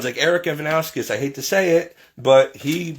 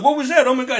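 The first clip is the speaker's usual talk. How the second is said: high, urgent imitation of a startled parent; alarmed; 10 semitones higher, much faster than usual. Father running in after hearing a crash, alarmed. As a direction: blurt it out in mock panic.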